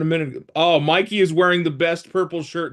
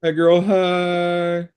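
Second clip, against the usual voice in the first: put-on drawn-out girly greeting; 5 semitones higher, 4 dB louder, much slower than usual.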